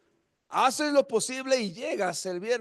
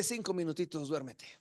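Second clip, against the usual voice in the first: much softer, lower drowsy murmur; sleepy flesh; 7 semitones lower, 11 dB quieter than usual. Acted. The flesh coaxing the sleeper to stay in bed, drowsy and much softer. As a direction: coax yourself back to sleep, barely voiced. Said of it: "Pero la carne te dice".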